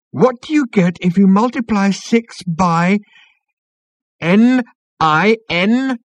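very high, loud, letter by letter; high, excited, spaced-out announcing; thrilled read-out. A narrator's reading. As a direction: call out each piece in suspense as the question forms. Spelling out the great question, thrilled.

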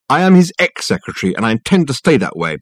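indignant, starting high; defiant secretary. Acted. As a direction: declare it with huffy finality. Secretary declares she has quit.

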